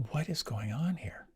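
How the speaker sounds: breathy whisper, puzzled; a private, bewildered whisper in a crowded room; whispered bewilderment. Retold or imitated. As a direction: whisper it to yourself, baffled. Bewildered inner thought in ashram.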